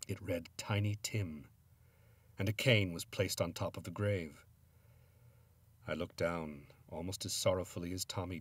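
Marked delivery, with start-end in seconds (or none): none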